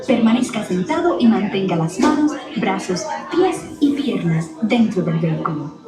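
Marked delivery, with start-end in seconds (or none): none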